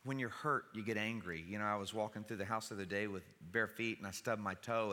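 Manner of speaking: ordinary delivery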